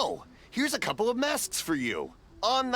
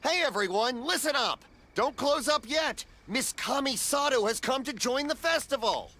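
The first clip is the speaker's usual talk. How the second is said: higher calling voice to the street; 6 semitones higher than usual. Real, not acted. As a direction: call to the whole festival. Shopkeeper calls out to the stalls.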